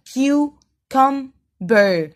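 "cucumber" as CU - CUM - BER, slow; teacher sounding out "cucumber" syllable by syllable; three separate syllables. Shown as a demonstration. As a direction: break "cucumber" into three spaced beats. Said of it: "three syllable".

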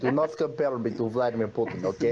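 put-on Russian accent. Russian accent denial bit.

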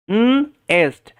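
"উম এস্ট" as long nnn, gap, then est; sounding out. Shown as a demonstration. Teacher modelling nest for learners, sounded out slowly. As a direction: slowly split nest after a held n. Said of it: "kindly একটু করবেন".

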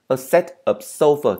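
"sofa" high on SO, falling to a weak "fuh"; teacher's phrase with "sofa" as SOH-fuh; stress on SO. Shown as a demonstration. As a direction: punch the first syllable of "sofa". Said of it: "cho âm rơi vào chữ so đó".

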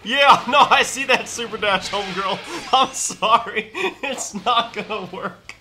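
talking through laughter; laughing. Real, not acted. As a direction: giggle through the words. Laughing while mocking opponent.

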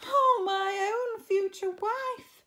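high, loud, drawn-out lament; crying out. A narrator's reading. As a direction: wail it slowly and dramatically. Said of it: "cried Harry".

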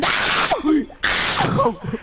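very high squealing laugh. Real, not acted. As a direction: burst into high-pitched laughter. Amused mid-match.

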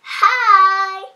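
bright shouted hi; loud greeting call. Real, not acted. Child shouting hello to the viewers, loud and cheerful. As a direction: call out hi to the camera.

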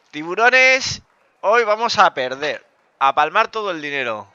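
high, loud mock-heroic call to the crowd; rally the sharks like a doomed captain; excited announcement. Real, not acted. Streamer rallies viewers before losing, excited loud call.

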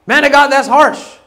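lightly mock a member who finds Paul too harsh; a hint of a whining congregant; mock church complainer. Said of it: "Someone say".

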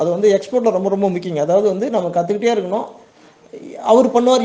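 ordinary delivery

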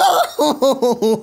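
high, loud burst of real laughter; sudden squealing laugh. Real, not acted. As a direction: crack up at your own crash. Laughs at own crash.

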